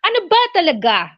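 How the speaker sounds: high, fast angry question; angry, upset tone. Shown as a demonstration. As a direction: demonstrate someone upset demanding an answer. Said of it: "angry or upset, you know, the tone of their voice change".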